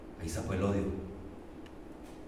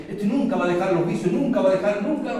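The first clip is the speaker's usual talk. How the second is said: 1 scornful gossip; 8 semitones higher, 7 dB louder than usual. Retold or imitated. Mocking what people say.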